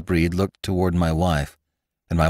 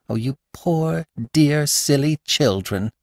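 higher, slow, pitying exclamation; tender cry; 7 semitones higher than usual. A narrator's reading. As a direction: gather the young wife in with pity. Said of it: "my wife cried".

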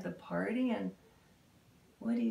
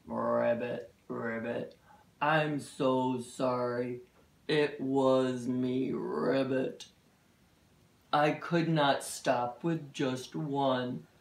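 low, slow croaky toad apology; low, slow toad saying sorry; 9 semitones lower, slower than usual. Acted. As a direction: voice a remorseful toad, slowly. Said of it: "Grumpy Toad".

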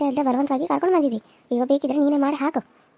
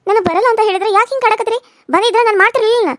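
angry protest; 5 semitones higher, 5 dB louder than usual. Acted. Wife refuses cooking, quarrel.

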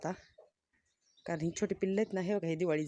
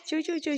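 put-on high, squeaky chirping of baby chicks; imitating chick chirps; 11 semitones higher, 5 dB louder than usual. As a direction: peep it out like a hungry chick. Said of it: "चिव, चिव, चिव सारखं चाललं होतं".

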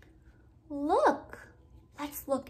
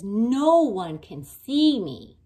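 lion voice; 9 dB louder than usual. Acted. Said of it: "Lion says".

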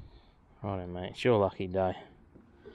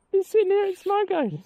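gleeful squeal; 5 dB louder than usual. Real, not acted. Fish taking bait.

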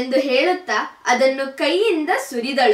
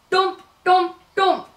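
heavy stomping steps; 7 semitones higher than usual. A narrator's reading. Big bear stomps upstairs.

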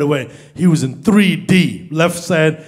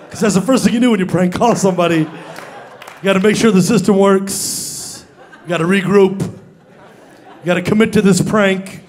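ordinary talk

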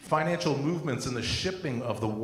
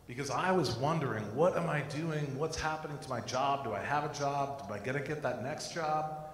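usual speaking voice

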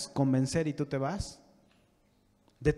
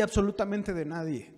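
ordinary delivery

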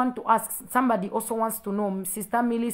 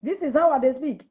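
higher, louder Nigerian-style phrasing with "dey"; Nigerian accent; 4 semitones higher, 5 dB louder than usual. Shown as a demonstration. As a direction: show off the Nigerian "dey", emphatically. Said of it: "The only Nigerian thing that I've picked".

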